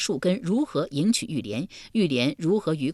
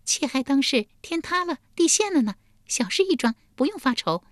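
high, light feminine voice; giggly consort; 9 semitones higher than usual. A narrator's reading. Li Ji brushing away the duke's worry, amused. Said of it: "骊姬嘻嘻一笑道".

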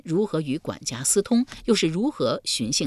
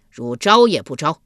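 loud, forceful interrogator's demand; shouted demand; 9 dB louder than usual. A narrator's reading. Guard demanding the revived prisoner confess, shouting. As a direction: bellow for a confession. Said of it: "侍卫喝道".

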